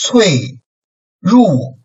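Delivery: cui and ru starting high, then dropping sharply; fourth tone. Shown as a demonstration. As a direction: snap cui and ru down from the top. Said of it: "like a zou".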